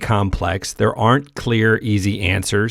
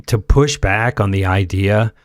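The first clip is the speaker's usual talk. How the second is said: normal talking voice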